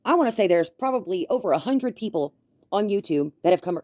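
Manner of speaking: normal talking voice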